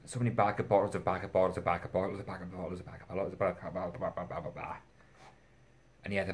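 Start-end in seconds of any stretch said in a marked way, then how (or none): 0.1-4.8 rambling repetition. Real, not acted. Reviewer mocking own pile of backup bottles, rambling. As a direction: rattle it off, trailing into babble. Mock rambling over repeated purchases.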